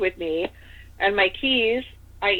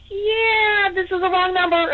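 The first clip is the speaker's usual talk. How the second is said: high, shaky, frightened squeak with a crying edge; spooked caller faking a wrong number; scared squeak; 12 semitones higher than usual. Retold or imitated. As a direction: blurt it out in a panic, voice cracking high. Panicked fake excuse to creepy call.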